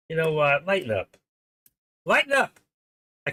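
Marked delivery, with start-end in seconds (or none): 2.1-2.6 much higher and louder, a real exasperated call; loud exclaim. Real, not acted. Host exasperated at silent listeners, loud high urging.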